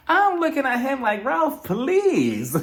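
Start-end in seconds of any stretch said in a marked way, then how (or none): 1.2-2.6 high, louder, laughing through it; can't take him seriously; laughing disbelief. Real, not acted. Host scoffing at Ralph's act, laughing higher.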